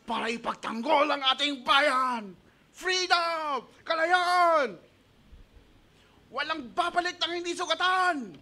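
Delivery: high, loud battle speech; rally the troops before battle; rousing general. Retold or imitated. Pastor playing a general rousing soldiers, loud and high. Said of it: "sasabihin ng leader".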